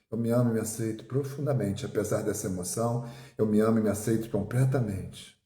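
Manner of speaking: hushed self-affirmation, low and soft. Acted. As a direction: say it gently to yourself, inward. Softened guided affirmation.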